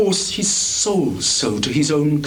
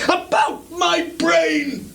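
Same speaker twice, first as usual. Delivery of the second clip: slow, much higher shout; 14 semitones higher, slower than usual. Acted. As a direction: drag it out as a cry. Rising rage peaks.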